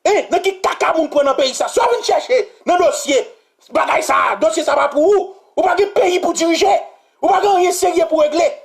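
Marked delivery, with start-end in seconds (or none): 1.7-8.5 outraged shouting; angry rant. Real, not acted. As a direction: hammer the questions at him. Continued rant.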